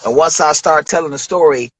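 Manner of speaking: ordinary talk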